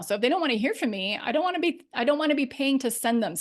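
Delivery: usual speaking voice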